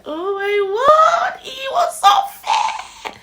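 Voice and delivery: put-on high, slow, loud cry of amazement at the baby; gasping wonder, drawn out; overjoyed cry. Mother seeing her newborn, crying out in amazement. First sight of second baby.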